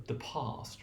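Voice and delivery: "past" with a long ah, whispered softly; Queen's English. Shown as a demonstration. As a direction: say "past" the posh British way. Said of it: "the Queen's English".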